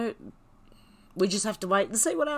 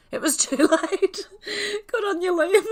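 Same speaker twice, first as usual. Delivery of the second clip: high, loud laughter breaking through the words; cracking up mid-sentence; laughing; 12 semitones higher, 6 dB louder than usual. Real, not acted. Streamer cracks up reading a viewer's joke, laughing.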